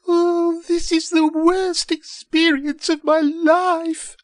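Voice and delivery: very high, loud squeaky little seed; hammed-up high-pitched lament; wailing seed. A narrator's reading. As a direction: wail in distress in a tiny high voice. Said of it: "she cried".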